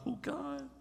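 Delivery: high, soft mock moan; a pitiful little plea to God, half-mocking; ugly praying. Preacher mimics needy believers praying, high soft mock moan. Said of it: "we do that ugly praying".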